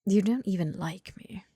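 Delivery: sulky whispered complaint; pouty hushed grumble; petulant whisper. Acted. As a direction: whisper it like a sulking child. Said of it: "petulant as ever".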